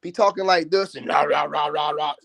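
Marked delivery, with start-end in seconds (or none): 1.1-2.1 a lightly voiced fake tough-guy rah; mock fake deep voice. Ranting YouTuber mocks rival's fake voice, lightly imitated. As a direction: mimic his put-on voice, mocking. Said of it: "Mister Fake Voice himself".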